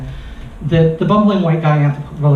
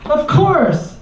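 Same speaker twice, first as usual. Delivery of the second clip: much higher, sing-song exclaiming driver voice; the driver suddenly gets it and chimes in high and happy; delighted taxi driver; 11 semitones higher, slower than usual. Retold or imitated.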